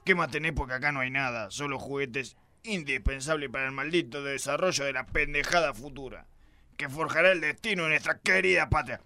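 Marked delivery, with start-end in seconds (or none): none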